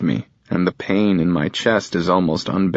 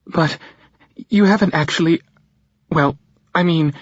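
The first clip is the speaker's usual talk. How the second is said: flustered mother; 10 semitones higher than usual. Acted. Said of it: "can't get her thoughts out".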